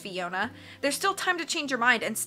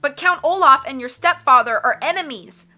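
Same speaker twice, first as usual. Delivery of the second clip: loud, higher-pitched outcry; cried out; 5 semitones higher, 10 dB louder than usual. A narrator's reading. Violet objecting about Olaf and the stepfather, crying out. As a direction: cry it out in protest. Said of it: "Violet cried".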